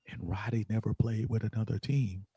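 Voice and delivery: murmured low, under the main point; quiet aside. Real, not acted. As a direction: drop right down for a side note. Aside inside an emphatic point.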